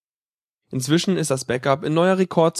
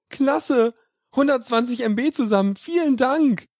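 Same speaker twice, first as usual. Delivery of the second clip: higher, eager thanks; delighted; 9 semitones higher than usual. Acted. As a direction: gush like a deal was just won. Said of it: "sagt er begeistert".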